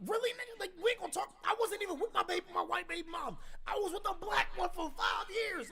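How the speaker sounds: high, loud exasperated outburst; indignant protest. Retold or imitated. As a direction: protest in a high, incredulous shout. Indignant protest at false assumption.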